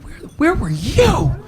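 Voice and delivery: high-pitched, drawn-out mock yell; imitated angry boss. Waiting boss confronts the late manager, high-pitched mock yelling. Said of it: "He was yelling at me".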